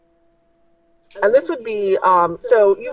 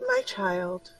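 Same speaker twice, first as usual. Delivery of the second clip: soft mystical voice; 12 semitones higher, 7 dB quieter than usual. Acted.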